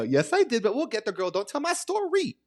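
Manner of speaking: squeaky high don't-spoil-it protest; playful high protest. Real, not acted. Teasing her friend not to spoil the story, squeaky high protest.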